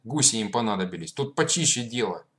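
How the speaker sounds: loud, furious telling-off; angry scolding. Acted. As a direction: yell at the noisy kids. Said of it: "запретил им орать и самих разогнал".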